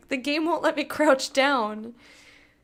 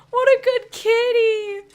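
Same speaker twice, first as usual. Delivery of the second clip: tearful, high and loud; choked up over a purring cat; 8 semitones higher, 10 dB louder, much slower than usual. Real, not acted.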